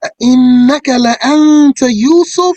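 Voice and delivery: high, loud chanted question; mind-blown. Acted. Brothers stunned to recognise Joseph, chanting in amazement. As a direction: chant it in ringing astonishment. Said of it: "These brothers are mind blown here".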